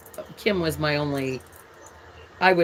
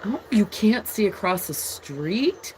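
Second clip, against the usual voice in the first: ordinary talk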